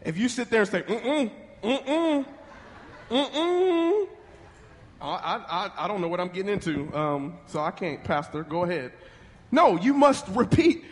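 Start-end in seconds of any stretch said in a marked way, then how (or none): 0.8-4.1 soft mm-mm rising higher each time; mock-hesitant closed-mouth refusal; reluctant refusing hum. Acts reluctant groom refusing. 5.0-8.9 low, soft stammering reluctance; mock-reluctant, hushed hedging; hesitant groom. Acts hesitant groom at altar.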